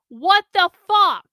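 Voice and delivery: loud, slow exasperated yell; yelling at someone. Real, not acted. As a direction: stretch it out in an indignant yell. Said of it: "Sorry, I'm yelling at Sin".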